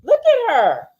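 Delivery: high, loud gushing; breathless mock admiration. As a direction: swoon over how she looks. Gushing over her look.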